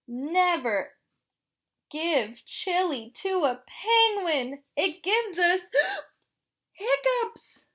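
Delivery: hiccupy little penguin, gasping; chatty penguin with hiccups; penguin character voice. Acted.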